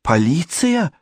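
very high alarmed daughter; frightened squeal. A narrator's reading. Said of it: "испуганно взвизгнула Джанет". (испуганный высокий визг)

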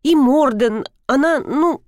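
high, hesitant search for a word; floundering excuse. A narrator's reading. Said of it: "стала выкручиваться я". (сбивчиво, высоким голосом)

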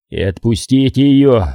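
usual speaking voice

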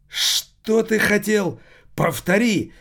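high, loud, slow shout of outrage. A narrator's reading. The owner's son shouts at Savigny in outrage. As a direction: bellow it, daring him to repeat. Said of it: "вскричал сын хозяина".

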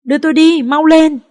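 high, very loud frantic shout; trembling scream. Acted. Bleeding woman in the car, frantic scream. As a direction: scream at them to hurry. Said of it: "chưa hết run và gào lên".

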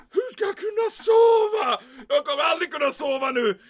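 loud, high, anguished shout; frantic officer losing his nerve; panicked cry. Acted. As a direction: wail in despair over the sleepless night. Panicked rant.

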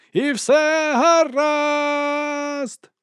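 very high, greatly drawn-out cry; bellowed watch call. A narrator's reading. Eager Carrot calls the all-clear over the town, bellowing. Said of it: "волав він".

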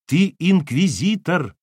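loud, slow, accusing blurt. A narrator's reading. Wife blurting an accusation at her ex-husband, loud. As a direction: fling it in his face. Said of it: "В лицо ему выпалила Кира".

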